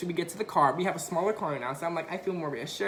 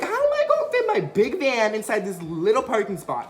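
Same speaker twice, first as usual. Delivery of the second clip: exasperated panic; 4 semitones higher, 6 dB louder than usual. Retold or imitated. Panicking about tiny space.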